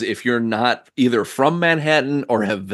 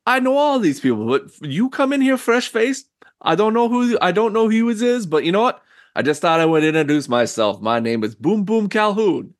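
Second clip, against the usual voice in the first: high, brash character voice; gangster greeting; 11 semitones higher than usual. Acted. Host introducing himself to the newcomer, high and brash. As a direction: come on friendly and pushy like a mobster. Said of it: "He's like".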